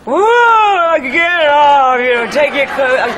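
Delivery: rowdy mock jeer, half laughing; mock heckler yell. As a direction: heckle her like a rowdy crowd. Heckling crowd retold.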